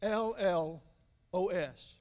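letters spelled slowly; A L L O S spelled slowly, one letter at a time. Shown as a demonstration. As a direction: spell each letter for the notes. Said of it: "you can write it down".

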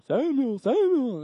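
drawn-out, swooping mock-ghostly call; eerie calling voice. Boy imitates the voice for his pastor, eerie. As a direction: imitate a mysterious voice, stretching the name. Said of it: "what does the voice sound like".

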